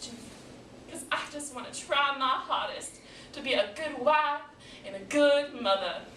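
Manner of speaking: aggrieved wife's rant; fed-up wife, resentful. Acted. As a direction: vent your grievances at your husband. Same character, venting at husband.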